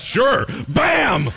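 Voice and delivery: high, loud shouted hit; punchy shout. Acted. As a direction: yell it like landing a punch. Prophet punches the other prophet.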